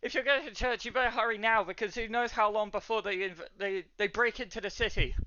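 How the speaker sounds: high-pitched, hurried warning; worried townswoman; urgent barwoman. Acted. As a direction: press them to move in a high voice. Voices alarmed barwoman.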